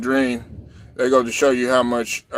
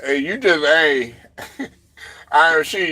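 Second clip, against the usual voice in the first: ordinary talk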